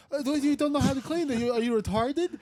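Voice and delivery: high-pitched mock boss, berating; mocking incredulity. Boss berating worker about cleaning, imitated incredulity. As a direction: imitate her in a high, disbelieving voice.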